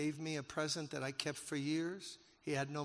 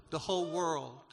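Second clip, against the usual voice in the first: preaching emphasis; 3 semitones higher, 7 dB louder than usual. Real, not acted. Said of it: "preacher echoes for emphasis".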